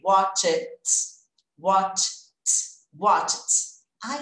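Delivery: Watch it with a clear T at the end, no extra vowel; past tense T sound. Shown as a demonstration. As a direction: say Watch it, closing on a crisp T. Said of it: "vou acrescentar o som do T".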